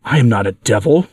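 ordinary delivery, nothing marked

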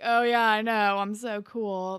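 loud opening, mock-cool boast; retold put-on swagger; fake bragging. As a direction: imitate a boy playing along as the stud. Retelling boy playing along, bragging.

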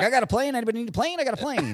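hawker voice. Retold or imitated. Busker seaplane pilot hawking rides.

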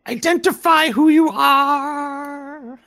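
high, slow menacing voice; eerie creature voice. Acted. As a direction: draw out each word, creepy.

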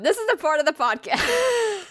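high-pitched holding back laughter; stifled laughter. Real, not acted. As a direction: fight the giggles, voice climbing. Trying not to laugh.

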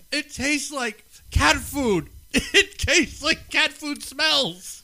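laughing through the words, slowly; disgusted laugh while talking. Real, not acted. Tasting awful chips.

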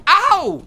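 sudden loud yelp; pained yelp. Acted. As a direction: yelp in pain.

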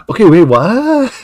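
high, loud baffled what; sudden double-take; confused surprise. Retold or imitated. Retold confused reaction.

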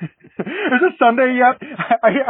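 high-pitched, laughing through the words; giddy, can hardly wait, breaking into laughter; laughing impatience. Real, not acted. Fan can't wait for Sunday's game, laughing impatience.

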